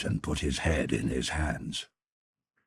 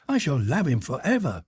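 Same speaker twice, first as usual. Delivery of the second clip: higher, louder declaration; a girl swearing eternal love; ardent cry; 11 semitones higher, 5 dB louder than usual. Acted. Sybil to her brother, crying out her devotion. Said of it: "she cried".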